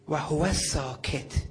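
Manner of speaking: usual speaking voice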